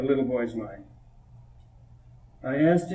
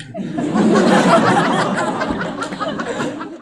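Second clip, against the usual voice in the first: big high laugh. Real, not acted. Laughs after child's poem.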